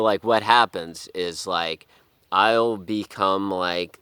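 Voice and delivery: normal talking voice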